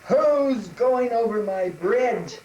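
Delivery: loud, slow troll roar; drawn-out, booming challenge; menacing troll. Acted. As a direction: bellow it like an angry troll. Troll challenges the little goat.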